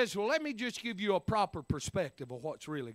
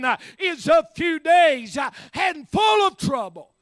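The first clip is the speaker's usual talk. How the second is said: loud, raised-pitch sermon cadence; full-force preaching; 7 semitones higher, 12 dB louder, slower than usual. Real, not acted. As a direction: drive each phrase home to the congregation.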